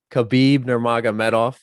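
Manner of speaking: usual speaking voice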